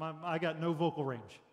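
ordinary talk

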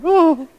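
high-pitched "oh" imitating his mother; mother-like gasp. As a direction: coo it high, like a moved mother. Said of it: "I was saying like my mother".

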